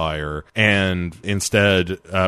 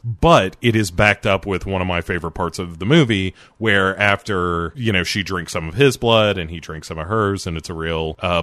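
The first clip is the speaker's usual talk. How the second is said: ordinary talk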